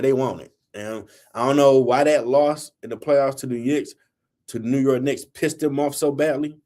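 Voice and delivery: normal talking voice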